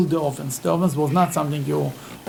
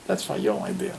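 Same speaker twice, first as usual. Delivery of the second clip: whispered nudge, barely voiced; hushed aside; 9 dB quieter than usual. Father-in-law whispering to his son-in-law, hushed. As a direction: slip him the line under your breath.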